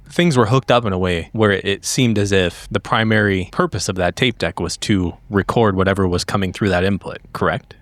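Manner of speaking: ordinary talk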